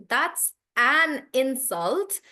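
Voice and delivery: slow, emphatic IN at the front of insult; stress on IN. Shown as a demonstration. Teacher recapping the noun, slow stress on IN in insult. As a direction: insult drawn out slowly with IN stressed. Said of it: "So I'm stressing on in".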